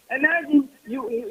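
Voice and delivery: ordinary talk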